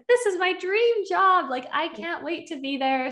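mock-giddy excitement; excited. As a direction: gush like it's the best news ever. Retelling excitement over dream job.

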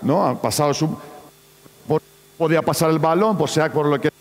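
normal talking voice

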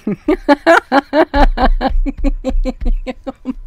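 long giggling laugh; can't stop giggling. Real, not acted. Reader laughing at Pinocchio.

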